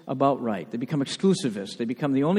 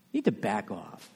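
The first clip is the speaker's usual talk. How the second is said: much softer, dropped low; quieter repeat; 7 semitones lower, 10 dB quieter than usual. Real, not acted. Preacher pressing the rebuke home to attackers, much softer. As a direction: let the rebuke sink to near a murmur.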